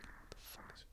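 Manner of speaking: whisper. Retold or imitated. Stoned neighbor mutters looking outside.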